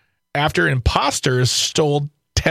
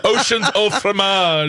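laughing; 6 semitones higher than usual. Real, not acted. Joke pun with laughter.

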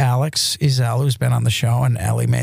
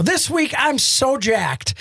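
excited; 10 semitones higher, much slower than usual. Real, not acted. Host hyping up the guest.

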